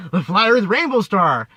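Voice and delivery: normal talking voice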